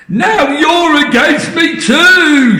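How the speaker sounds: very high, loud, slow shout; outraged shout. Acted. Grandma shouting at her daughter, loud slow outrage. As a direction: bellow the betrayal. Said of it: "shouted Grandma".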